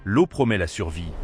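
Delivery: ordinary delivery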